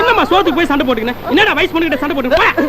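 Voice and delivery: high-pitched exasperated telling-off; scolding. Acted. Breaking up a quarrel over food. (கடிந்துகொள்ளும் குரல்)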